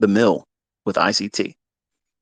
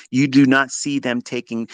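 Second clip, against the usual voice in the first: normal talking voice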